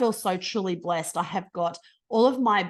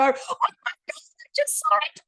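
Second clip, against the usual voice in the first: much higher, squealing with delight; excited little kid; 6 dB louder than usual. Retold or imitated. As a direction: gush like a kid who just swam with a turtle. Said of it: "like this excited little kid and go".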